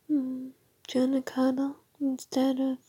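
ordinary talk

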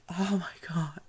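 whispered oh my God, high and airless; breathless whisper. Retold or imitated. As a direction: gasp it out in a whisper, knees going weak. Her whispered reaction after good girl.

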